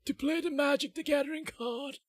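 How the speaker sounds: put-on high, strained old man voice; creaky elderly wizard croak. Shown as a demonstration. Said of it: "I can do old man".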